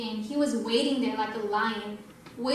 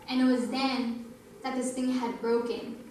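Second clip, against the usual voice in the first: normal talking voice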